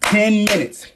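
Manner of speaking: ordinary delivery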